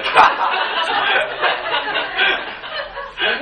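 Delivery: high falling laugh, loud; long loud laughter. Real, not acted. Pastor laughing hard at his own joke. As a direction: laugh it all out.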